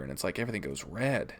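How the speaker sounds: usual speaking voice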